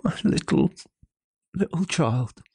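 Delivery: loud, broken cry of a father; breaking down in grief. Acted. Bob crying out for his lost child, anguished. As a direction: let the voice break between words. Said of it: "cried Bob".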